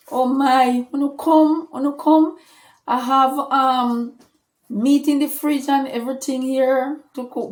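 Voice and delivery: a hint of an excited host; eager invitation. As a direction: call them in, keen to host. Friend eagerly inviting them over.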